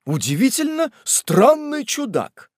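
high-pitched wondering remark; puzzled wonder, pitched high; amazed exclamation. Acted. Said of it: "воскликнул мистер Пиквик".

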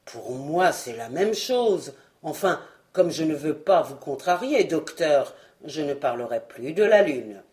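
voix grave et conciliante de géant; un grand gaillard qui cède sans rancune; colosse bonhomme. Acted. Fred's low colossus voice. (Fred répond au docteur, grave et conciliant)

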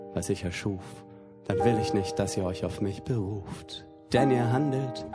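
usual speaking voice

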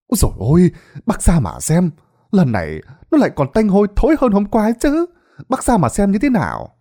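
high, fast, pressing voice; urgent villager. Acted. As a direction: hurry him out to see. Villager urges Tín to look. (giục giã, giọng cao và nhanh)

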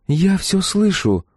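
put-on slow, high-pitched girl's voice; drawn-out high retort from a young woman; overhearing girl. A narrator's reading. Girl to the man complaining about her, pointed retort.

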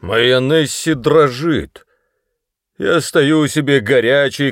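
grand fatherly melodrama, overdone; drunken pathos. A narrator's reading. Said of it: "воскликнул с настоящим пафосом". (с пьяным пафосом)

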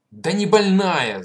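loud, slow, high-pitched cry; shout. Acted. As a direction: shout it out to convince. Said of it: "закричал он".

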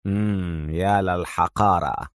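contempt. A narrator's reading. Tony alone after Jones leaves, soft scornful mutter. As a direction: mutter of disdain. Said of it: "في ازدراء".

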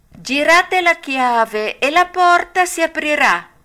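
high, loud little call from inside; a tiny voice answering the knock. A narrator's reading. The cricket calling from inside the hut, tiny voice. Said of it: "disse la solita vocina".

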